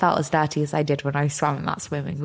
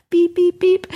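high, loud spoken beeps; imitated censor beep; 11 semitones higher, 6 dB louder, slower than usual. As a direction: beep over the rude part.

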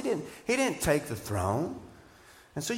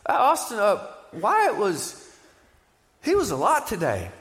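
nervous; 4 semitones higher, 3 dB louder than usual. Retold or imitated. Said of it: "with deep trepidation in his voice".

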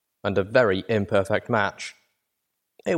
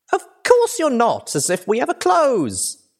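put-on very high, loud girlish glee; bubbly high-pitched exuberance; exuberant, giggly; 5 dB louder than usual. A narrator's reading. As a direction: burst out merrily like an excited filly. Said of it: "she giggled exuberantly".

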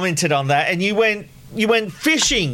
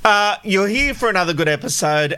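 ordinary talk, nothing marked

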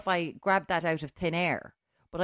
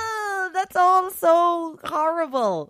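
horrified shriek; 11 semitones higher, 8 dB louder, much slower than usual. Real, not acted. Reacting to embarrassing story.

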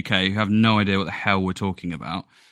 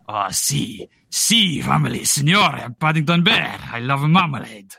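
hammed-up Spanish accent for Paddington; mock Spanish accent. Host imagining Paddington Bear with a comic Spanish accent. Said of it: "he'd be a Spanish Paddington Bear".

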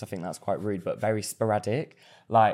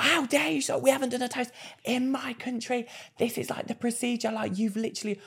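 mock screaming manager; 13 semitones higher, 4 dB quieter than usual. Imitating the manager scolding him over the toast. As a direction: squeak out the manager's outrage. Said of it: "started fucking screaming at me".

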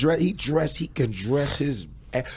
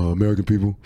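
imitating a bad impressionist; Obama; 5 semitones lower, much faster than usual. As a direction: put on a hesitant presidential impression. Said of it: "Watch me do Obama".

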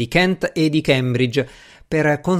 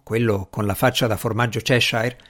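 ordinary delivery

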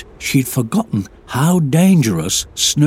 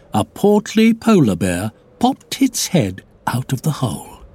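ordinary delivery, nothing marked

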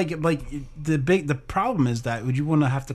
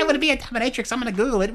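mock excited wife; 6 semitones higher, faster than usual. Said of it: "mimicking wife".

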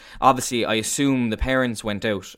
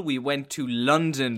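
usual speaking voice

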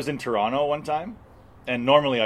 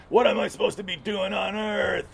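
high, earnest mock question; mock seeker; 9 semitones higher than usual. Parodying a tripper pleading to the universe, mock earnest. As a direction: lift it up like a lost soul asking the sky. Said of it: "Where it's like".

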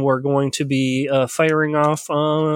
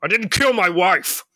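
higher, mock-desperate movie hero; Harrison Ford; 4 semitones higher than usual. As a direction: plead innocence in a hammy film-star impression. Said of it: "I think that was Harrison Ford".

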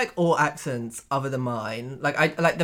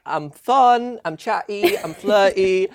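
mock bubbly contestant intro, pitched up; dating-show contestant; 5 semitones higher, 5 dB louder, much slower than usual. Contestant introducing themselves over theme music, mock bubbly. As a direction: play a perky contestant introducing herself, louder.

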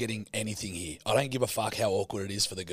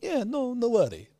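much higher, sing-song barman voice; bartender impression; 13 semitones higher than usual. Sicilian bartender reassuring the customer, in a much higher voice. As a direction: play the cheery local barman. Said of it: "He's like".

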